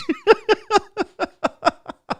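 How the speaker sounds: high laughter. Real, not acted. Laughing at politics fans.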